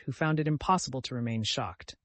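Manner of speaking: ordinary delivery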